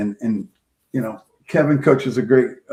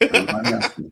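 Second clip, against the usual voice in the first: the same words again, much higher and teasing; playful high repeat; 11 semitones higher than usual. Real, not acted. Coach repeating his answer about videotaping, playful high repeat. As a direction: tease it back in a lifted voice.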